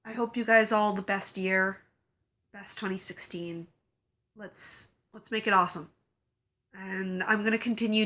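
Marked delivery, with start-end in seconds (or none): 2.5-5.3 soft whispered aside; whispering. Real, not acted. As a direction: breathe it like a secret.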